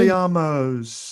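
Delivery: a slow, hammed-up wizard casting a spell in a put-on English accent; Harry Potter spell. Showing off his English accent to friends, hammed-up Harry Potter spell. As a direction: cast the spell like a schoolboy wizard, overdone.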